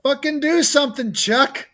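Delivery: mock-frustrated shout at a film hero; retold yell. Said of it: "I audibly yelled at my screen".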